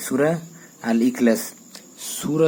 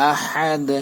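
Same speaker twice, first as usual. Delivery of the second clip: normal talking voice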